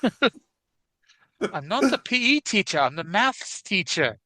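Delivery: slow, put-on bad English accent; hammed-up English schoolteacher accent; a deliberately poor English accent, drawn out. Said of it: "my really bad English accent right there".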